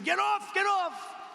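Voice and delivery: hammed-up shouting to get him off stage; mock screaming. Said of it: "So they're screaming".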